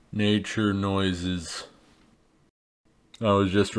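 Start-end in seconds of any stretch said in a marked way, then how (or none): 0.1-1.6 slow flat-read nature noises cue; lazily spoken sound effect; deadpan sound cue. Acted. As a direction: draw it out deadpan, like reading a stage direction.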